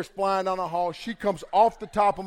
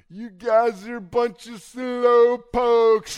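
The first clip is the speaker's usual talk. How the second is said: very slow, higher and louder imitation of Nicole; mock trash talk; 6 semitones higher, 7 dB louder, much slower than usual. Nicole taunts the people coming into line, slow and higher. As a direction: drag it out like her teasing. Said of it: "talking dirty trash to people".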